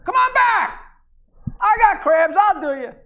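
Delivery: high, loud call across the room. Retold or imitated. Madam calls him back.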